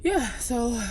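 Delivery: breathless, heavily pregnant; out of breath, panting between words. Real, not acted. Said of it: "excuse me for being out of breath".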